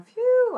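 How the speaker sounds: high, loud whew of relief; exaggerated sigh of relief; relieved phew. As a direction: let out a big relieved "phew". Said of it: "he, of course, is going".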